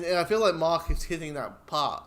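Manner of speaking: normal talking voice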